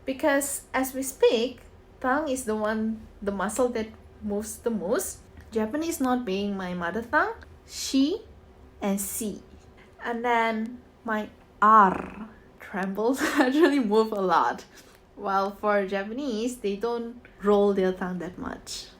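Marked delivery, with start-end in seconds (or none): none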